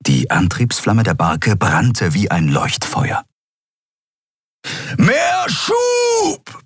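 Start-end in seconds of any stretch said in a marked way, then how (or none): 5.0-6.6 very high, drawn-out yell; roaring shout. A narrator's reading. Kero orders the pilot from the door, roaring. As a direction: bellow it across the whole ship. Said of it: "brüllte Kero quer durch das Schiff".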